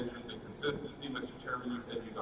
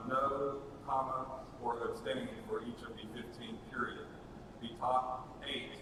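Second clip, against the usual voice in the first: dictating. Shown as a demonstration. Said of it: "the language, uh, will say".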